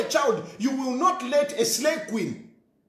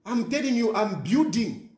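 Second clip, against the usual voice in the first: ordinary delivery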